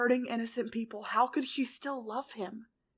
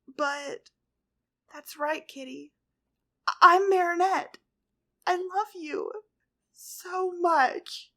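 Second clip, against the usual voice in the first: tearful; 7 semitones higher, 7 dB louder, slower than usual. A narrator's reading. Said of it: "Her voice wavered with tears".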